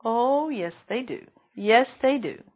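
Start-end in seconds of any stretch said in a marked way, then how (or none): none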